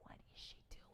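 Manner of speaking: soft then fully whispered; imitating Joanne's nervous whisper. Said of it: "She's like".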